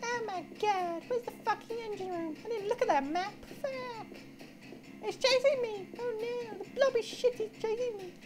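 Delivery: high, panicked cries while fleeing; scared horror game streamer. Real, not acted. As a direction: panic as it chases you. Still chased, panicking.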